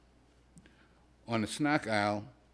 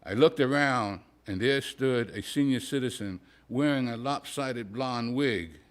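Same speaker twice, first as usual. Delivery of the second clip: plain reading voice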